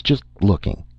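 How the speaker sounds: usual speaking voice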